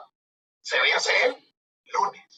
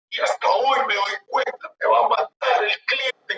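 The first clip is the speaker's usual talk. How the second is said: higher-pitched, lightly acted despair; mock tearful lament; 7 semitones higher than usual. Procurador fearing his client's anger, mock tearful. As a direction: imitate a distressed man dreading his client. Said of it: "lo veo llorando".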